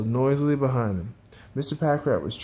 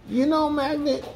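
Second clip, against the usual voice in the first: high character voice; earnest Mister Packrat; 13 semitones higher than usual. A narrator's reading. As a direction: confide it in a high little voice. Character addresses Magnet, realizing his mistake.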